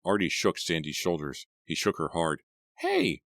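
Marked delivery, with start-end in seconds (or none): 2.8-3.2 very high urgent cry; high alarmed cry of hey; cried out. A narrator's reading. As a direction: call out in alarm. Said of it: "Artie cried".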